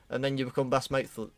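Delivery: ordinary delivery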